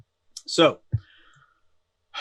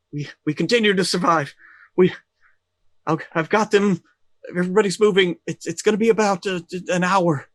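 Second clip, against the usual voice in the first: high, loud, halting radio report; raised, excited voice with broken-up phrasing; Barry; 7 semitones higher, 6 dB louder than usual. Acted. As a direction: stammer out urgent news over the line. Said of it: "you hear Barry's voice be like".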